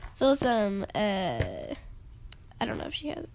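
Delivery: ordinary talk, nothing marked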